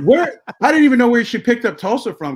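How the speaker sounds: loud, high-pitched incredulity; baffled disbelief. Retold or imitated. Storyteller puzzling over where mother got Tulsa from, baffled.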